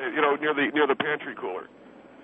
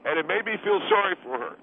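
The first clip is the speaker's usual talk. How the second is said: indignant; 10 semitones higher than usual. Acted. Indignant outburst.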